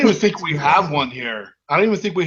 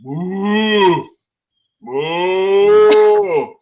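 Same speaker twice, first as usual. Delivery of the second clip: panicked turkey whooping, a bit louder; turkey before Thanksgiving; 4 dB louder than usual. Shown as a demonstration. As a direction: hoot like a turkey that knows it's doomed. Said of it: "mine is a turkey the day before Thanksgiving".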